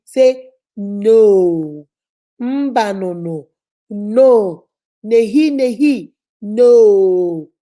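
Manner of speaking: a firm, punched NO said over and over; firm no drill. Shown as a demonstration. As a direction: lead the class in saying a firm "No". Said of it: "Now, practice it with me".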